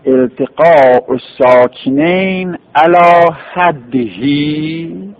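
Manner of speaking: التقاء ساکنین علی حدهی said very slowly, word by word, with علی stressed; very slow dictation. Shown as a demonstration. (التقاء ساکنین علی حدهی را خیلی آهسته و شمرده بگو)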